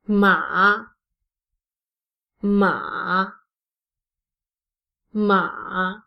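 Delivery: MA low, mostly downward; "ma" dropping low, with little lift; third tone. Shown as a demonstration. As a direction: sink each "ma" to the bottom of the pitch range. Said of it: "I will pronounce the third tone".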